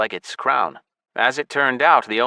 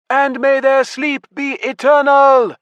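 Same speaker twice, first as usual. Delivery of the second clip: much higher, loud, slow proclamation; crying out; 7 dB louder, slower than usual. Acted. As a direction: cry it out to the clan. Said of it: "Yoruba cried".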